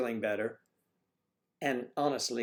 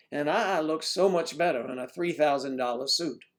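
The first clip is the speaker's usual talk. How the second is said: loud, higher hammed-up TV preacher begging for money; mock televangelist working the crowd for cash; preacher impression; 7 semitones higher, 8 dB louder than usual. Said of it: "so I'd impersonate the preachers".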